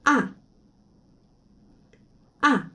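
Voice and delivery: ah dropping sharply; fourth tone. Shown as a demonstration. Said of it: "Ton quatre". (quatrième ton, ah tombant fortement)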